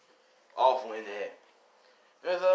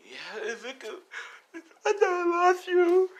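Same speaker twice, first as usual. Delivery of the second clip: much higher, slow, wailing; hammed-up tearful father; mock sobbing; 4 dB louder, slower than usual. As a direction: wail it like a big baby. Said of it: "he's crying like a big baby".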